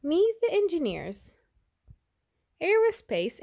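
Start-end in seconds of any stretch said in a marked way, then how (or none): none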